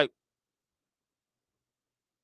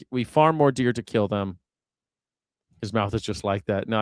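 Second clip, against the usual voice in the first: ordinary talk, nothing marked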